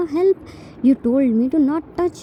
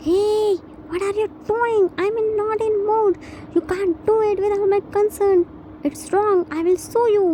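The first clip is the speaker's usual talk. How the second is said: higher pitched protesting voice of Jimin; Jimin voiced high; 6 semitones higher than usual. Jimin protests to his husband, higher indignant voice.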